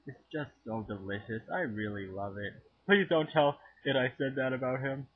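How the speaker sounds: usual speaking voice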